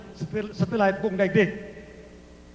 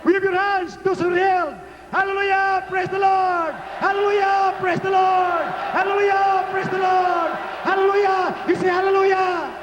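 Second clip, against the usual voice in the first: high, sustained praise chant; rally the crowd with shouted hallelujahs; full-force preaching; 9 semitones higher, 3 dB louder than usual. Real, not acted. Leads congregation in praise chant.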